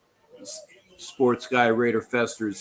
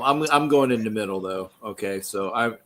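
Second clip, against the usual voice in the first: ordinary talk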